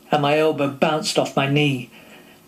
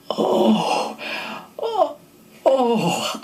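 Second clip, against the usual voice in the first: rising breathless croak breaking up into a laugh; 10 semitones higher, much slower than usual. Retold or imitated. Young actor opening the door to his idol, croaking. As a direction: choke the oh's out, each one higher. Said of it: "I croaked".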